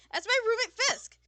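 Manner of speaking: high, very loud and gushing, speeding up; excited shout. Acted. Sullivan explaining the long-lost roommate to Paige, excited shout. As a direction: yell it high, then rush on excitedly.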